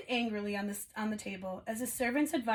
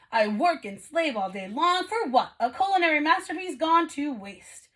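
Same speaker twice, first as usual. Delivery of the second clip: louder, aggrieved complaint; complaining; 5 semitones higher, 6 dB louder than usual. A narrator's reading. The stove complaining to Missus Potts, loud and aggrieved. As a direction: grumble about wasted work. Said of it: "heard the stove complaining".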